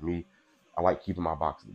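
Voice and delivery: ordinary talk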